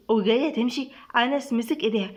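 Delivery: plain reading voice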